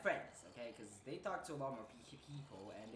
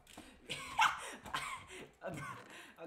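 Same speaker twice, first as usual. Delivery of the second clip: high laughing burst; 6 dB louder than usual. Real, not acted. Laughs at own answer.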